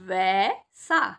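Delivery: वैसा with the ऐ stretched long and loud; drawn-out vaisa. Shown as a demonstration. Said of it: "जब हम किसी चीज़ को ज़्यादा खींच के बोलते हैं". (वैसा को खींचकर बोलना)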